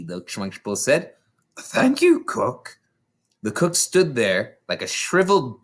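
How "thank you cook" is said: put-on boy voice.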